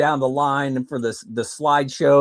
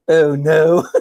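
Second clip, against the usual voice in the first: laughing; 4 dB louder than usual. Retold or imitated. Dread before boss talk.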